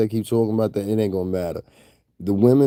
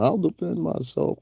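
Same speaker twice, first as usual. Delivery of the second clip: mocking tough talk; 9 semitones higher than usual. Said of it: "talking about".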